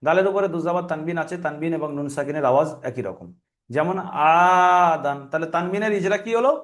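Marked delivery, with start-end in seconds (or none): 4.0-5.2 "আদান" with the opening "আ" held long on a steady note; elongated vowel. Shown as a demonstration. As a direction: stretch the first vowel of "আদান", then finish "দান" short. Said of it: "এক আলিফ পরিমাণ টান দিয়ে পড়তে হয়". ("আদান"-এর "আ" লম্বা টান দিয়ে পড়া)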